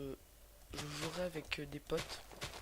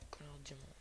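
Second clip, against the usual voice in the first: under-the-breath whisper; whispered curse; 9 dB quieter, faster than usual. Real, not acted. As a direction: hiss it under your breath. Muttering curse at diamond.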